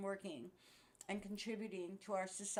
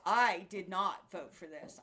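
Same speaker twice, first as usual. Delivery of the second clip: louder, slower, every word hammered; emphatic repeat; 10 dB louder than usual. Real, not acted. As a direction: hammer it home slowly.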